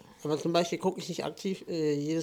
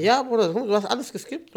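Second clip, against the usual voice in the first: empört; 7 semitones higher, 4 dB louder than usual. Real, not acted. Beschwert sich beim Freund.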